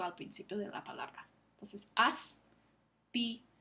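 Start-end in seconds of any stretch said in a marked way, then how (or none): 1.9-3.5 "aspirar" very slowly as as-pi-rar; teacher drawing "aspirar" out by syllables; slow syllabified. Shown as a demonstration. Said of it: "tenemos que separar la S de la T".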